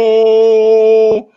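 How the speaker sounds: long, booming "uh-oh"; big sustained call-out; loud drawn-out uh-oh. Real, not acted. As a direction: stretch the "oh" out loud and hold it. Excited interjection at friend's shout-out.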